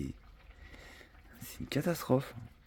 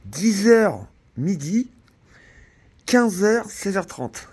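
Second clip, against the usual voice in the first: mocking; 11 semitones higher, 10 dB louder, much slower than usual. Mocking the town hall hours.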